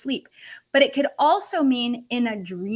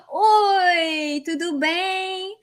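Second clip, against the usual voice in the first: high, drawn-out happy hello; delighted greeting; 7 semitones higher, 3 dB louder, much slower than usual. Real, not acted. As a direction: greet a surprise guest with high, stretched delight. Greeting a Brazilian viewer in Portuguese.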